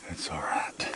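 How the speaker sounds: soft unvoiced whisper. Real, not acted. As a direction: drop to a whisper. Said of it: "I was talking too loud".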